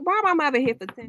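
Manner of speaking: usual speaking voice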